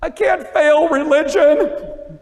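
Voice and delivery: high, loud, slow mock sob of a student; teacher mimicking a student crying over her test; imitating a crying girl. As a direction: drag it out like a tearful teen. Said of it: "She started crying".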